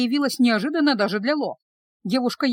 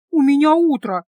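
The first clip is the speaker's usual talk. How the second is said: loud, higher-pitched grumble; grumbling; 4 semitones higher, 7 dB louder than usual. A narrator's reading. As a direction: grumble in protest at the early hour. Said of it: "проворчала юная чародейка". (ворчливо, погромче и повыше)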